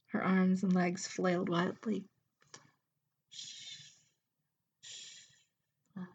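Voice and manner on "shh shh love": very soft breathy shush; hushing. Acted. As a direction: quietly hush a frightened lover. Mistress hushes struggling Emma.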